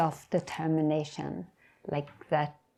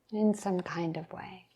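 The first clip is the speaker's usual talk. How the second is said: hushed, softer "In some kind of way"; soft reflective echo; 6 dB quieter than usual. Real, not acted. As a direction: let it fall away almost to a whisper. Softly echoes her own phrase.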